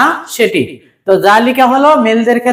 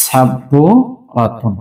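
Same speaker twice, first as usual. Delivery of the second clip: slow careful word; 9 semitones lower, much slower than usual. Shown as a demonstration. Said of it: "sorry, এখানে wow হবে".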